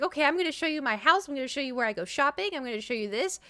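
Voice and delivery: high, rapid-fire imitation of an eager host; excited. Friend eager to show guests her house, excited. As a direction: rattle it off, each item rising.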